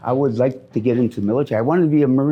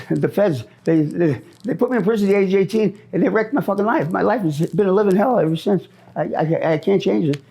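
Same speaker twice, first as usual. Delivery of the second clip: agitated rant; 6 semitones higher than usual. Real, not acted. Angry rant about the feds.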